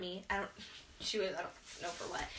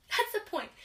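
playful retort; 11 semitones higher, 4 dB louder than usual. Retold or imitated. Retorting to her girls.

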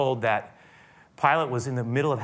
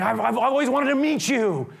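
high, loud coaxing; eager Herod; 12 semitones higher, 5 dB louder than usual. Acted. Herod coaxing Jesus, high and loud. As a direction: flatter him to get a show. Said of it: "tries to get him".

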